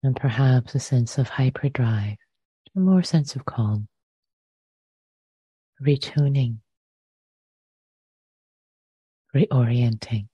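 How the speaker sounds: unhurried meditation guidance with long pauses between phrases; guided meditation. Real, not acted. Teacher inviting listeners to settle into the body, guided meditation. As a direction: lead them gently into the breath, leaving lots of silence.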